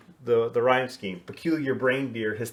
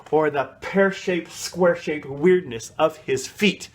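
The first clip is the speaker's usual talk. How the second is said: "Pear-shaped, square-shaped" with the P and the SQU popped out higher and louder; crisp consonants; 4 semitones higher, 4 dB louder than usual. Shown as a demonstration. Teacher shows how to hit the consonants in pear-shaped. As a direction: punch the squa in "square-shaped". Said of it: "you're really emphasizing those consonants".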